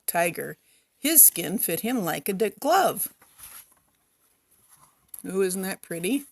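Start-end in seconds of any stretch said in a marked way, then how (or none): none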